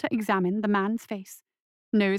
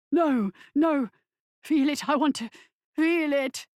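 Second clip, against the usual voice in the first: moaning; 5 semitones higher than usual. A narrator's reading. Patient refusing anesthetic, moaning high. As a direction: moan it with desperate craving. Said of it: "Wilkes moaned".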